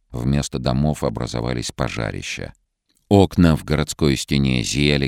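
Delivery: ordinary delivery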